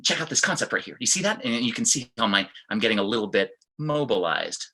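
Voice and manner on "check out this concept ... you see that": fast and higher, tense and rushed; hurried, keyed-up teacher; speaking quickly under stress. Shown as a demonstration. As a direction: rattle it off like you're stressed. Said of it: "start to speak quickly".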